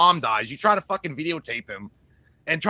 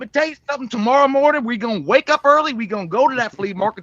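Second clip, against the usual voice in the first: hammed-up backwoods Southern accent, rallying the boys; trailer-trash Southern accent; 5 semitones higher, 6 dB louder than usual. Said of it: "trailer trash type of fucking, uh, accents".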